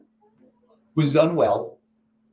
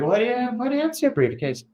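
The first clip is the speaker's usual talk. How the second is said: puzzled question; 10 semitones higher than usual. Retold or imitated. Asking Jimmy about two briefcases, higher and puzzled. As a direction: lightly play his confused self, pitched up.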